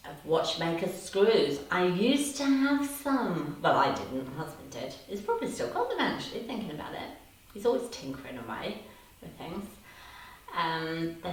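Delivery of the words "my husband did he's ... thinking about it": usual speaking voice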